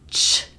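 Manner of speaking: crisp voiceless "ch"; ch demo. Shown as a demonstration. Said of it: "So voiceless".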